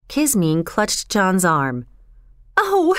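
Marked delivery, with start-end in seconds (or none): 2.6-2.9 high, startled outcry; high-pitched, frantic exclamation; wild cry. A narrator's reading. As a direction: cry out wildly as the girl. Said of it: "she cried wildly".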